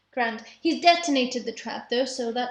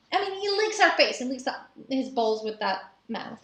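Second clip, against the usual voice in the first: ordinary delivery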